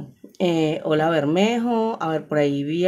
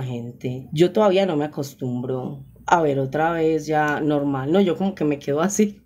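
ordinary talk, nothing marked

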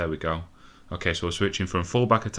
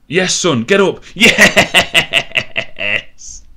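much higher, much louder cheer; ecstatic shout; 9 semitones higher, 11 dB louder, much slower than usual. Real, not acted. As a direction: roar it as the keeper saves. Last-gasp save celebration.

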